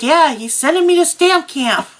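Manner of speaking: wife repeating news to a disbelieving shop owner; emphatic repeat. Retold or imitated. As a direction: insist it through a grin.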